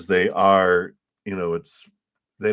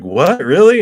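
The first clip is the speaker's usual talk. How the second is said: mock disbelief, higher and louder; a squeal of happy disbelief; astonished; 12 semitones higher, 11 dB louder than usual. The viewer hearing of the free upgrade, astonished and delighted. Caller's astonished reaction acted.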